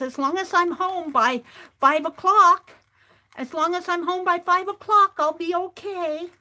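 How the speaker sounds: put-on high, small voice of a tiny gnome child; high little girl gnome voice. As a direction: play the little gnome pleading to stay out late. Gnome girl quoted in story.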